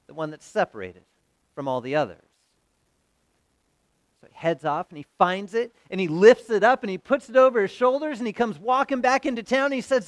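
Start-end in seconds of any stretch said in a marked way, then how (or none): none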